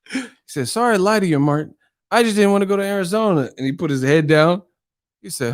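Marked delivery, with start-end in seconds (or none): 0.7-3.5 put-on high, quick kid voice; little boy. Boy apologizing to Martin, lightly voiced high child. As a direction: hurry through a childish apology. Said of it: "that little kid with them ears".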